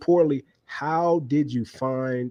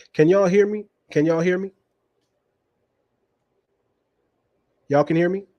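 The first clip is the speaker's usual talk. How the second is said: raised, higher-pitched audio-check question; calling out to check; 6 semitones higher, 4 dB louder than usual. Real, not acted. Host asks listeners if the audio works, calling out to check. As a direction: call out higher, checking you're heard. Checking if the audience hears him.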